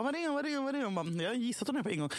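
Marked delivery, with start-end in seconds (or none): 0.0-1.0 flustered repeating. Retold or imitated.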